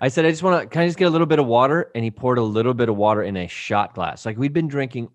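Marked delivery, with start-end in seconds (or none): 0.2-1.9 loud, fast, higher-pitched ask; hurried request. Retold or imitated. Asking the bartender for water, loud hurried plea. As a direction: blurt it out over bar noise.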